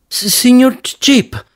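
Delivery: nervous stammer, pitched high. A narrator's reading. Semimundo nervously stammers Joe's name, high and halting. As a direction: stumble over the name, high and timid. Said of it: "balbettò Semimundo".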